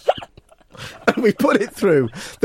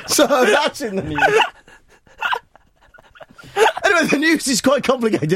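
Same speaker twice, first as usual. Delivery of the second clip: laughing; 10 semitones higher than usual. Real, not acted. Host cracking up on air.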